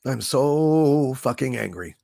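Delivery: slow, low, seething; quiet fury. Real, not acted. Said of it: "really angry".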